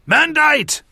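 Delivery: very high shouted cry. A narrator's reading. Two fighters shout as the shield spell fails, shouted. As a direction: cry it out sharply at high pitch. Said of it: "both Cadal and Torum shouted".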